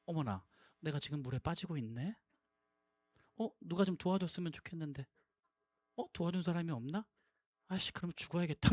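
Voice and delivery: very soft, low, overly composed murmur; mock genteel prayer. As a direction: play at quietly, politely wishing for help. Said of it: "고상하게 침묵 기도하거나". (고상한 척 나직하게 중얼거리듯)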